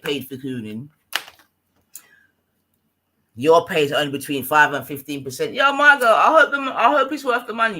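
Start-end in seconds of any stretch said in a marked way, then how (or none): 5.5-7.8 loud mocking jab. Real, not acted. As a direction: yell the sarcasm across. Mocking rival on stream.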